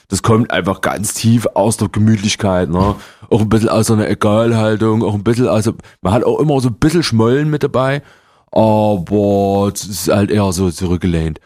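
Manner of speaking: lightly voiced laid-back Dresden Saxon. Shown as a demonstration. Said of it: "das ist guttural". (leicht angedeutetes gemütliches Dresdner Sächsisch)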